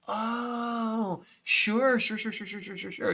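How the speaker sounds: dismissive sure. Retold or imitated. Mocking people who claim drafting is easy, soft dismissive brush-off. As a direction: wave it off softly, sure sure sure. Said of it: "It's like".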